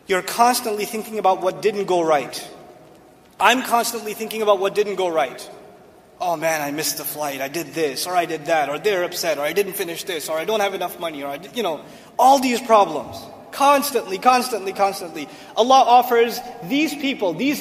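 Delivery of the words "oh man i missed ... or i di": low, soft run of complaints; worried grumbling. Acted. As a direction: fret under your breath, item after item. Voices someone dwelling on problems.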